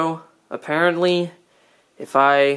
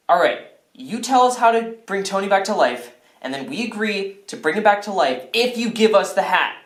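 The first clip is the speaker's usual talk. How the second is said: ordinary delivery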